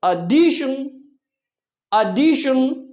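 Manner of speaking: एडिशन as addition, short a start. Shown as a demonstration. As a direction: model एडिशन twice. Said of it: "इसको बोलेंगे".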